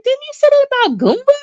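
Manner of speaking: high, fast, sassy comeback; high mock sass; mock incredulous retort. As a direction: fire it back with disbelief. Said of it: "And I'm like".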